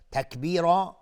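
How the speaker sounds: high, loud «تکبیرا» with the final vowel held long; madd iwad. Shown as a demonstration. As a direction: chant «تکبیرا», stretching the last "a" instead of tanwin. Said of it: "مد عوض".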